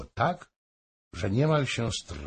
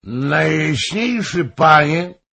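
much louder, higher cry; shouting; 7 semitones higher, 10 dB louder, slower than usual. A narrator's reading. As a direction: rise from the seat and cry out. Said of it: "krzyknął unosząc się Hoym". (głośny okrzyk)

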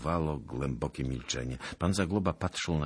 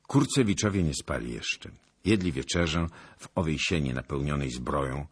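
usual speaking voice

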